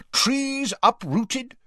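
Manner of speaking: slow, high-pitched angry list; outraged, spaced-out catalogue; barked outrage. A narrator's reading. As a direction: punch out each item, voice high. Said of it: "now positively stamping up and down".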